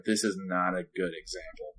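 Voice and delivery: low, soft self-reproach; quiet retold inner scolding; muttering to himself. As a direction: say it under your breath to yourself. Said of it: "I even said this to myself".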